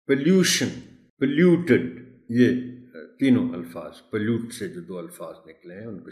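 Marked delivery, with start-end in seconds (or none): 0.1-1.9 "پولیوشن" and "پولیوٹڈ" starting puh, pitched high; reduced first vowel. Shown as a demonstration. Teacher drilling derived words, "پولیوشن" with a schwa first. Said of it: "اے sound ہے".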